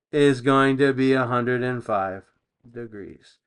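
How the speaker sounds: ordinary delivery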